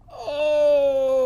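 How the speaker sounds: loud high groan; rowing groan, loud and high; great big groan. Shown as a demonstration. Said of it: "give a great big groan".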